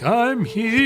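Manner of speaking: high eerie ghost call; seance spirit voice. Shown as a demonstration. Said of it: "saying, like".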